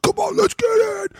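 very high, strained shout; imitated hype yell. Hype man in a sportswear commercial, imitated yell. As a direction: yell it like a crazed lifting spotter.

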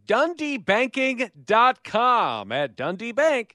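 high, slow ad announcer read. Acted. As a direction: announce the website slowly. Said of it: "I'm gonna give the website".

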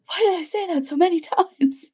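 gasping, high mock dismay; hammed-up realization after a gasp. Gasp, mock self-reproach.

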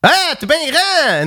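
high, surprised French street question; French accent. Retold or imitated. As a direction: ask it like an amazed local. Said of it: "people always stop him and go like".

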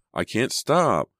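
high, slow, drawn-out cry on stop; panicked cry leaping up on the last word; escalated panic. A narrator's reading. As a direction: wail it in desperation.

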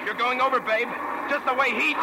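menacing threat. Acted. Threatening to kill her.

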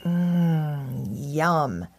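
long relishing "mm"; drawn-out contented hum; savoring. A narrator's reading. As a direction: savor it to yourself. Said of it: "he thought as he looked forward".

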